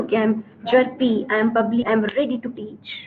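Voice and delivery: ordinary talk, nothing marked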